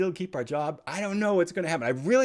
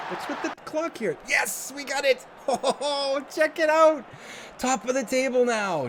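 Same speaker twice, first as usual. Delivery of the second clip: excited; 10 semitones higher than usual. Real, not acted. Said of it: "Sorry if I'm getting excited".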